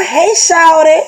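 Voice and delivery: high, loud catcalls; hammed-up catcalling; mock catcaller. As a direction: call out like a guy on the street.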